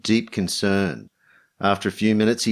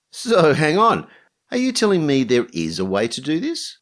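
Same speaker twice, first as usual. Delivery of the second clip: higher-pitched, startled and questioning; shocked disbelief; 6 semitones higher than usual. A narrator's reading. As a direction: stop short and question it in disbelief. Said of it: "He looked shocked".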